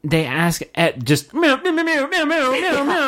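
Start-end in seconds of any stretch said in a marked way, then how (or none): 1.3-3.1 high, squealing meows mimicking a sax; mock sax squeal. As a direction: squeak it out like a bad saxophone. Mocking the bad sax playing.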